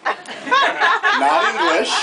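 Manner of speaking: not English stretched very slow and high; drawn-out not English. Shown as a demonstration. Comic insists to the crowd, not English, drawn out high. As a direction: drag out not English to mock it. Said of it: "the languages I speak are American".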